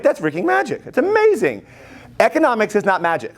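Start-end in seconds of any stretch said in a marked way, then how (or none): 0.0-1.6 high-pitched mock awe; imitated amazement. Teacher voicing the student who has no clue, mock amazed. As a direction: imitate a clueless spectator, voice shooting up. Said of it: "He's like".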